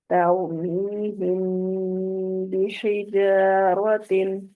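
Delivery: slow Quranic chant with the meem hummed through the nose; recitación con gunna. Shown as a demonstration. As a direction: draw out "तौमीहिम" into a long nasal hum before "बिहिजारतिन". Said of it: "कैसे पढ़ेंगे इसको".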